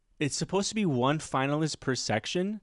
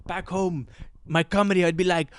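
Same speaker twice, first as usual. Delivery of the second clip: high-pitched, hammed-up Israeli accent; old Israeli guy; 7 semitones higher than usual. Comic mocking the old Israeli guy's act, Israeli accent. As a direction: imitate an old Israeli clown, noises pitched way up. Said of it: "this old-ass Israeli guy being like".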